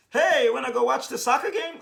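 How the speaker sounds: high, loud mock interruption; exaggerated eager shout to get attention; loud hailing. Acts out interrupting Jesus.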